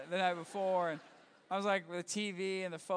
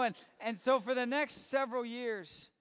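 ordinary delivery

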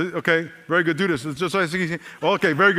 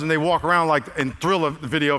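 ordinary delivery

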